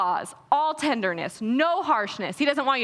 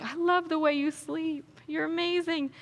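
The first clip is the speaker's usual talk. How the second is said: much softer, hushed and loving; tender God voice; 3 semitones higher, 4 dB quieter than usual. Retold or imitated. God speaking to a sleeping child, soft and tender. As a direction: murmur it over someone sleeping. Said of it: "he's just there like".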